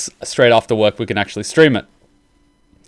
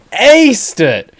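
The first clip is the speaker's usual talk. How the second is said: slow, louder, higher; excited emphasis; 9 semitones higher, 3 dB louder than usual. Real, not acted. Praising the voice actor to viewers, excited. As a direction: punch it out like a thrilled fan. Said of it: "sorry, I get a little excited".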